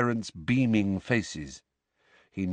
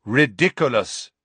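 firm and clear; 3 semitones higher, 6 dB louder than usual. A narrator's reading. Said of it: "very firmly and clearly".

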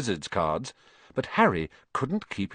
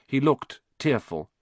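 usual speaking voice

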